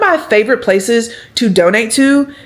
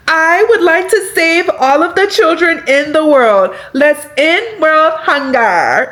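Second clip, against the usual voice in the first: lofty, slow, much higher pageant-speech voice; mock grand do-gooder; 7 semitones higher, slower than usual. Mocking the idea she wants to save the world, lofty slow voice. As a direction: ham up a saintly savior-of-the-world tone. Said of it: "that's not like".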